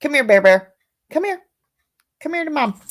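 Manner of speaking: high sing-song coaxing to her dogs; dog talk. Real, not acted.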